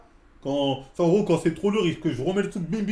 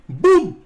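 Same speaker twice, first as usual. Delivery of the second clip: loud imitated crash of weights; loud boom imitation; 4 semitones higher, 12 dB louder than usual. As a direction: shout the crash of the dumbbells. (imitation forte du bruit des haltères)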